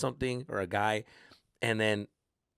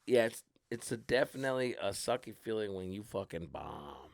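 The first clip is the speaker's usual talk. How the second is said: ordinary talk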